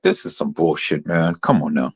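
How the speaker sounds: low, soft muttered aside of the cop; mock cop aside. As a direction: voice the laughing cop under his breath. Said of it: "It was like".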